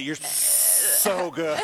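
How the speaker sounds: slow, hissed S on "so"; playful sibilant snake praise. Acted. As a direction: stretch "so" out like a snake. Plays snake praising another snake.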